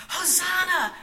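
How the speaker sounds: loud, high cheer; excited cry. A narrator's reading. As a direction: shout it with childish joy. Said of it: "Miriam cried".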